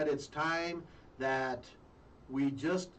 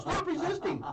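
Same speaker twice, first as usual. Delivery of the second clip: mock cop shout; 10 semitones higher than usual. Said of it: "Hit you with the billy club".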